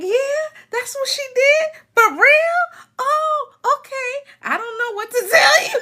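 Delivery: high-pitched mock concern breaking into laughter; feigned sympathy. Playing along with a relative on the phone, mock-sympathetic high voice. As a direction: humour the caller in a very high voice.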